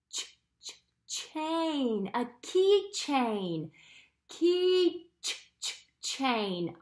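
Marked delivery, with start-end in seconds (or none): 0.1-2.1 ch- ch- whispered, then chain; teasing out the ch in chain; sounding out. Shown as a demonstration. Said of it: "It's called a key". 4.3-6.8 key ch-, ch-, chain with the ch repeated; isolating ch in keychain; sounding out. Shown as a demonstration. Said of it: "what do we hear?".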